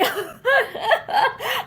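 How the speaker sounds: short bitter laugh; hollow bitter laugh; mirthless laugh. A narrator's reading. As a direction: laugh without any joy. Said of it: "He laughed mirthlessly".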